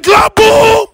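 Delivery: high, loud furious kaboom; mad shout. Shown as a demonstration. Host shows fan a mad kaboom, loud shout. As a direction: shout it like you haven't eaten.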